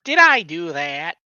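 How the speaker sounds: put-on nerd voice, stretched out; Urkel. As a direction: draw it out like a TV nerd. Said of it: "No, that's Urkel".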